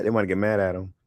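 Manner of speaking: usual speaking voice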